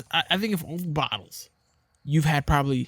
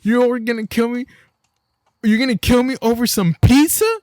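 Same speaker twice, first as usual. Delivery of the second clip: put-on high, loud kid pleading; whiny little kid; 10 semitones higher, 8 dB louder than usual. Kid in the video pleading with his dad, high whine. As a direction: plead like a small kid in disbelief. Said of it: "he's all like".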